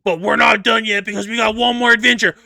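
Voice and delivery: high, excited hero announcement; Star-Lord. Retold or imitated. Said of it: "Star-Lord. He's like".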